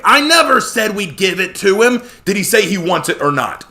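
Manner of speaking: loud, high sharp demand; angry demand. Acted. Joshua angrily pressing Connor for an answer.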